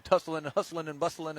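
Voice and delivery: usual speaking voice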